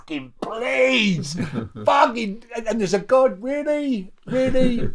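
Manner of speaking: high, loud whimpering plea; mock terrified pleading. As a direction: beg like you're terrified. Terrified pleading, quoted self.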